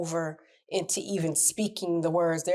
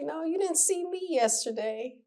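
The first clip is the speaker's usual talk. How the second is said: playful denial; 11 semitones higher than usual. Retold or imitated. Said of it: "I'm like".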